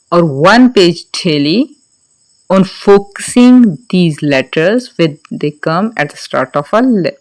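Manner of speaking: ordinary delivery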